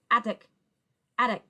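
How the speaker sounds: "Attic" blended quickly into one word; fast together. Shown as a demonstration. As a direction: run the syllables of "Attic" together without a gap. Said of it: "I'll say those syllables fast together".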